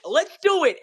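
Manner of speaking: joking along with the host; mock eagerness. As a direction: cheer it on like a hype man.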